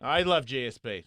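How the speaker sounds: lightly imitating a Canadian accent, low. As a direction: echo the caller in a mild Canadian accent. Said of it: "So he sounds Canadian".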